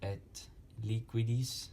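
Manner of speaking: "Et liquidis" sounded out slowly, beat by beat; spondee scansion. Shown as a demonstration. Teacher marking the next foot, slow scanning of "Et liquidis". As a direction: scan "Et liquidis" slowly and deliberately. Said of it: "that's a spondy".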